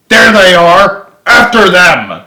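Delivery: loud, high battle cry calling his troops; commanding shout. A narrator's reading. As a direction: yell the order to charge at full volume. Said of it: "Henderson heading towards them".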